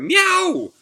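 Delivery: hoog, luid kattengemiauw; a shrill little cat cry; cat meow. Acted. Cat creature cries out.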